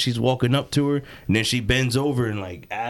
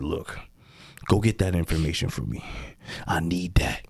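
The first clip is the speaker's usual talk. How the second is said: low-pitched, hushed request; acted grave commander; 7 semitones lower, 5 dB quieter than usual. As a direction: imitate a man quietly giving orders. Acts out the guy giving orders.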